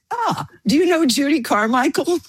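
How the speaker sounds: high-pitched retold fan's delight; excited recognition. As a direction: imitate a guard lighting up with excitement. Guard excitedly recognises a connection.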